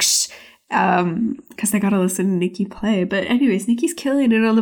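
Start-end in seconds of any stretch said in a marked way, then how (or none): 0.0-0.3 mock "shh" of an audience member; imitated shushing. Lady in the audience hushing the man beside her, imitated shush. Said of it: "the lady beside him is like".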